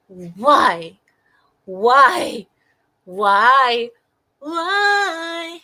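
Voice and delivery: loud exasperated demands; repeated shouted why. Real, not acted.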